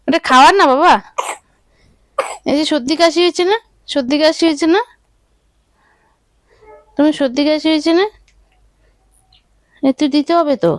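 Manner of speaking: high, gentle coaxing motherese; baby talk. Acted. Mother soothing her sick baby about nose drops, in high coaxing baby talk.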